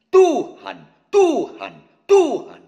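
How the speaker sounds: Tuhan sung out high and loud with tu on the strong beat; stress on tu. Shown as a demonstration. Preacher demonstrating the right beat for Tuhan, loud. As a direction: belt Tuhan, tu first and strong. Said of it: "kata tunya harus di ketukan pertama".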